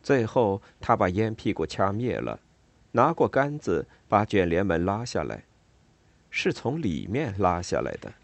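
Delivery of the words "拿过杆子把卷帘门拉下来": usual speaking voice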